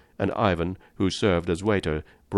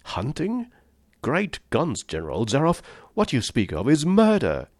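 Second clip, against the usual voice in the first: high, rising incredulous question into protest; appalled disbelief; 7 semitones higher than usual. A narrator's reading. Guest confronts General Zaroff over hunting men, appalled. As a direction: recoil in higher-pitched outrage.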